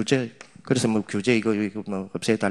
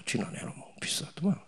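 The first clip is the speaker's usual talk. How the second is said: soft unvoiced shrug; whispered dismissal; 4 semitones higher, 10 dB quieter than usual. Real, not acted. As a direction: whisper it like it's nothing. (별거 아니라는 듯 속삭이는 말투)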